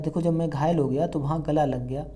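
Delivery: normal talking voice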